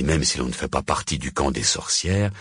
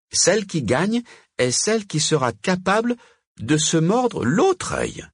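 high-pitched witch setting the challenge; Margot; 12 semitones higher than usual. A narrator's reading. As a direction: lay down the rule in a high witch voice.

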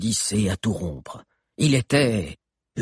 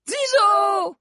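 high shout, much louder. A narrator's reading. Chrysante shouts to Zizo even louder, high. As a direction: yell the name across the street. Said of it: "cria-t-elle encore plus fort".

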